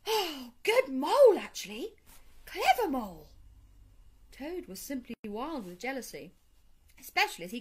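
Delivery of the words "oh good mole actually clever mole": lavish praise on a clever friend; admiring praise. A narrator's reading. Badger heartily praises mole.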